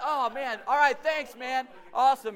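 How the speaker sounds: high, hammed-up thanks; staged gratitude. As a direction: gush your thanks for eavesdroppers. Staged thanks for stall listeners.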